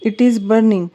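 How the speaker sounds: plain reading voice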